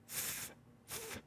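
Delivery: th sound isolated and unvoiced; just breathe out the th sound, twice; th sound alone. Shown as a demonstration. Showing a sound that was hard to say, th sound alone. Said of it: "I had a hard time saying".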